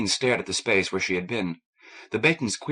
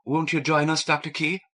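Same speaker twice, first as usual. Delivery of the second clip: a touch of shyness, higher; timid question; 7 semitones higher than usual. A narrator's reading. Kathleen timidly invites Doctor Key to join, mildly shy. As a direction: ask it hesitantly, a little higher. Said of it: "Kathleen asked timidly".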